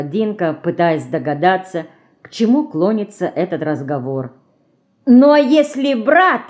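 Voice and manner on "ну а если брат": sly, higher, louder and slow; snide. A narrator's reading. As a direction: needle her with a pointed question. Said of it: "ехидно сжимая губы".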